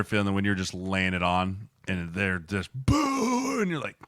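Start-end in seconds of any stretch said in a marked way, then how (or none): 2.7-3.6 much higher, drawn-out mock crowd boo. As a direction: imitate a stadium booing. Imitates home crowd booing.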